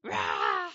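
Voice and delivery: a big loud rah of a cheering crowd; victory cheer. Retold or imitated. The Israelites cheer after Goliath falls, loud whoop. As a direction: whoop like an army that just won. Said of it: "the Israelites are like".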